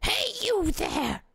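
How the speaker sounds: put-on high, slow old lady voice; squeaky old lady guard. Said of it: "There we go, that's perfect".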